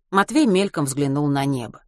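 plain reading voice

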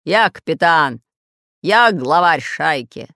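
loud pirate growl; slow, forceful bandit bellow. A narrator's reading. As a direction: roar like the boss of the gang. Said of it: "рычал Буль-Буль".

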